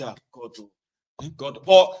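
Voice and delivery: ordinary talk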